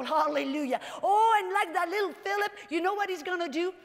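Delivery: ordinary delivery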